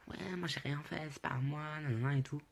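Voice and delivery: put-on plaintive high voice; whiny mock protest. Friend protests on being thrown out.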